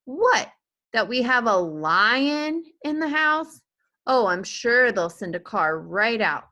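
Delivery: father mocking the idea of calling police; sarcastic scoff. A narrator's reading. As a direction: ridicule her idea.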